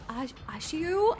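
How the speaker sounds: ordinary talk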